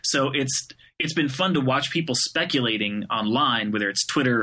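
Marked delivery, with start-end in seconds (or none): none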